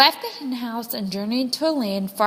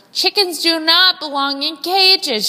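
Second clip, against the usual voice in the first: louder and higher, rousing the flock; rallying call; 6 semitones higher, 5 dB louder than usual. A narrator's reading. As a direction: call the hens to action. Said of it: "she told them".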